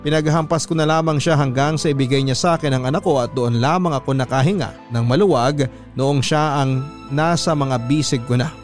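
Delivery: ordinary talk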